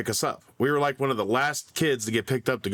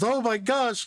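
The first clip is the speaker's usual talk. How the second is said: teacher surprised; 10 semitones higher than usual. Retold or imitated. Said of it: "the teacher notices".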